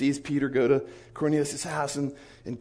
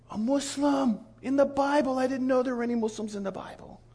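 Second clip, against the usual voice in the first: imitated astonishment; 9 semitones higher, 4 dB quieter than usual. Sheikh reading about Cornelius, amazed in a high voice. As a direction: marvel at an unexpected discovery.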